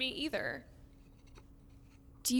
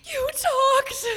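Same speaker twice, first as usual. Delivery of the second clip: very high, loud burst of amazement; astonished cry; 12 semitones higher, 8 dB louder than usual. Acted. Girl hears a skeleton talk, astonished cry. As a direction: cry out in disbelief. Skeleton has just answered.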